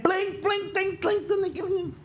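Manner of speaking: high popping blink blink; high blink sounds. Retold or imitated. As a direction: chirp each blink high. Teeth popping out effect.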